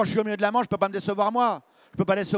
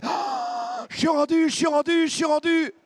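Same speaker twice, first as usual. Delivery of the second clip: desperate shout; 10 semitones higher, slower than usual. Retold or imitated. Shouting to bailiff.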